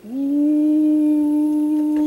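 a long high ooh; impressed ooh. Real, not acted. Admiring the cookie tray, a long high ooh. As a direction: let the ooh soar high and hold.

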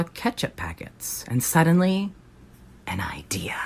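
a sudden idea, whispered; whispered reveal. Acted. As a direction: breathe it out like a secret.

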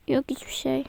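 soft unvoiced whisper; whispered denial. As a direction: breathe it out, hiding it. Denies while hiding something.

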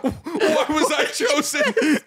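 high, drawn-out comic lament; hammed-up horror, pitched high; mock despair. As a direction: lament slowly through laughter. Voicing shaking camera holder, laughing.

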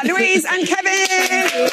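high, drawn-out names over applause; big showy sign-off; presenter's send-off. Real, not acted. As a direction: call out the names slowly to rouse applause. Host sends off guests to applause.

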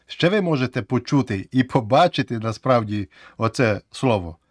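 ordinary delivery

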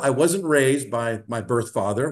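ordinary delivery

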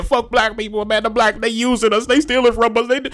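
high-pitched ranting parody; mock ranting. Vlogger mimicking a rant against his own people, mock ranting. Acting out angry rant he rejects.

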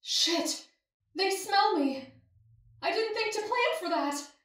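very high, hushed panic; panicked thought. A narrator's reading. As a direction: think aloud in a thin, urgent high voice. Heroine's panicked inner thought.

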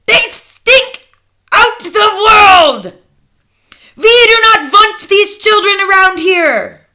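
loud, high witch rant; screamed. A narrator's reading. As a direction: shout it like a tyrant witch. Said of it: "she screamed".

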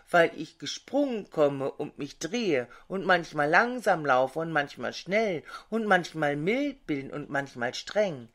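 ordinary delivery, nothing marked

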